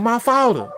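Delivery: high, loud mimicry of "father"; imitated New Orleans pronunciation. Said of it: "the way y'all pronounce things".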